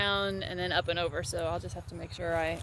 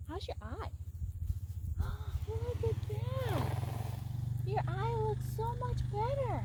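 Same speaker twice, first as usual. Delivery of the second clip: pet talk; 12 semitones higher, 7 dB quieter than usual. Real, not acted. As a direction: owner coos at the horse about its eye, high and soft. Baby talk to horse.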